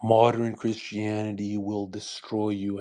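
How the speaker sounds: ordinary delivery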